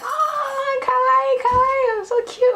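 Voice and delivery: very high, loud gushing squeal; piercingly high mock fawning; imitated fangirl squeal. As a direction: mimic girls squealing over something cute. Imitating girls fawning over her.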